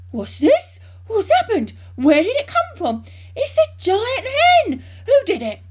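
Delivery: loud, high volley of exclamations; worked-up and excited. A narrator's reading. Mister Cranky waving his arms at the hen, excited shouting. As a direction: burst out loudly, each question a high cry. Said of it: "got him all worked up and excited".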